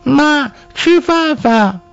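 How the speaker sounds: put-on very high, slow toddler voice; little girl. A narrator's reading. Little girl asking mother for food, high slow child voice. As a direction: draw it out high, in baby talk. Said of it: "小妞子过来拉住妈妈的手".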